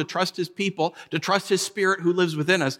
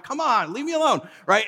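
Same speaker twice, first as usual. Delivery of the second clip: exasperated protest; 7 semitones higher than usual. Retold or imitated. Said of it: "And I'm like".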